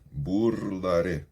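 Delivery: burlaré drawn out slowly, tapped r; slow R demo. Shown as a demonstration. Teacher repeating the word slowly for students. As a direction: stretch burlaré and tap each r. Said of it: "olha os sons do R".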